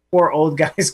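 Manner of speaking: normal talking voice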